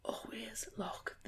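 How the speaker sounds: slow unvoiced warning whisper. Real, not acted. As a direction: breathe the warning slowly. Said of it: "whispered warning".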